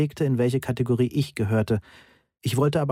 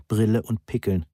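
usual speaking voice

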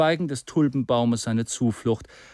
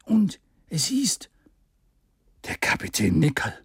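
hushed recognition. A narrator's reading. Character identifies the feared enemy.

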